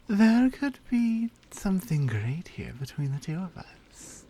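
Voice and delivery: usual speaking voice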